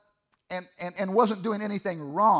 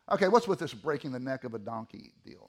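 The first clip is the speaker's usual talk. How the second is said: ordinary talk, nothing marked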